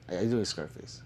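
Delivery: low, falling murmured question; quiet repeat. Real, not acted. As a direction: drop your voice and ask again. Asks chat twice, different tunes.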